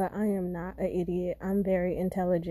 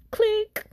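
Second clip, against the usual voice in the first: high mock hangup; 13 semitones higher, 4 dB louder than usual. Imitating hangup.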